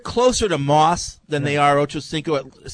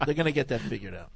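softer, faster, lower muttered repeat; quieter repeat; 6 semitones lower, 10 dB quieter, faster than usual. Real, not acted. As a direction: mutter it again under your breath.

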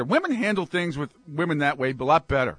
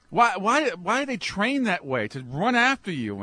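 ordinary talk

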